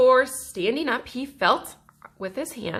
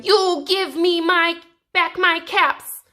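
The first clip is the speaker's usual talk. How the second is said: angry scolding; 6 semitones higher, 4 dB louder than usual. Acted. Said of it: "This made the peddler angry".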